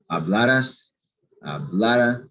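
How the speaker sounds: "Hablaras, hablara" each leaning firmly on "la"; stress on second-to-last syllable. Shown as a demonstration. Teacher models past subjunctive for students, "Hablaras, hablara" stressed on "la". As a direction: punch the middle a in "Hablaras, hablara". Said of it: "the stress is right here".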